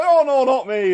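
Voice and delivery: high, loud protesting denial; acted protest of innocence; emphatic denial. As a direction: deny it loudly, pitch jumping up. Hasty denial when accused.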